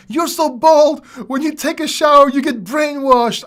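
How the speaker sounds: high, loud taunting voice, slowing at the punchline; mocking commenter joke. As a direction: deliver the insult like a heckler. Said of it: "There's a".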